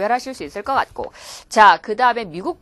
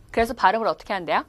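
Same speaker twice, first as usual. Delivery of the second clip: ordinary delivery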